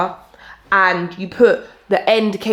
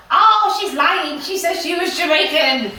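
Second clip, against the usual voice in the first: mocking gossip voice; 8 semitones higher than usual. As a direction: mimic a sneering gossip. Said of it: "when people come for me now".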